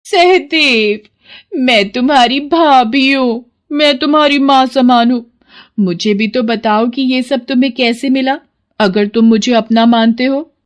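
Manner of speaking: high, insistent sister-in-law; high-voiced, pushy pleading; wheedling sister-in-law. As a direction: coax him with motherly claims, pitched high.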